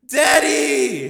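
put-on high, loud child's cry; child screaming in agony; terrified child scream. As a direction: scream in terror.

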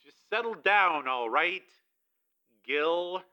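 slow, weary attempt to calm him. A narrator's reading. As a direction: wearily tell an agitated prisoner to settle.

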